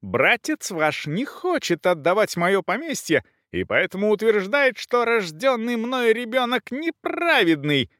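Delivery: high-pitched outraged tirade; indignant countess, voice strained upward; shouting indignantly. A narrator's reading. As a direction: cry the accusation out high, without full volume. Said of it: "кричала Брунхильда, краснея от негодования".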